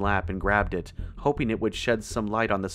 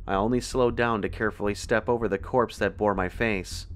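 plain reading voice

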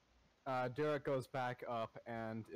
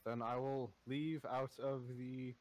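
normal talking voice

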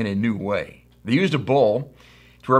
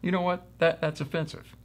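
higher, softer note of offence; mock offended; 6 semitones higher, 6 dB quieter than usual. Preacher answering the friend who called his life boring, mock offended. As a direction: act quietly affronted, pitch raised.

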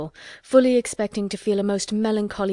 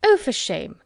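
put-on higher, louder indignant old aunt; indignant cry; 6 semitones higher, 4 dB louder, slower than usual. A narrator's reading. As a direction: scold him in mock horror. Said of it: "cried Missus Norris".

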